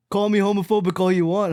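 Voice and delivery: ordinary talk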